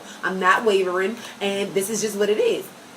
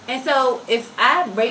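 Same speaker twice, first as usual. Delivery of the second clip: normal talking voice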